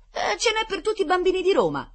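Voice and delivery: high, loud indignant assertion; almost offended. A narrator's reading. Rita defending the cake's size, almost offended. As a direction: affirm it as if slightly insulted. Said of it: "quasi offesa".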